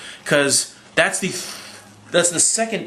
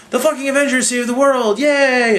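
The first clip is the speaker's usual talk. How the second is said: mock news cheer; 10 semitones higher than usual. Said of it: "news agencies saying shit like".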